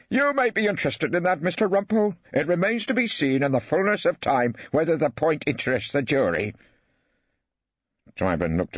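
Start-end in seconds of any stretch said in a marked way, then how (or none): 0.1-6.5 high, pompous judge voice reproving counsel; a high-pitched irritated old judge putting counsel in his place; testy judge. Acted. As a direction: judge snubbing the barrister.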